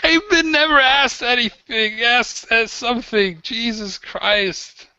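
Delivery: high, whining desperate prisoner; exasperated captive. Acted. Prisoner begging the interrogators to just ask something, whining high. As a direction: plead in a high exasperated whine.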